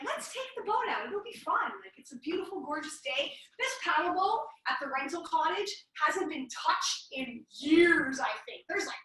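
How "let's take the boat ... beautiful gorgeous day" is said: higher, softer, carefree mock husband; imitating husband. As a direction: pitch it up lightly to coax someone along. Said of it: "Eric's like".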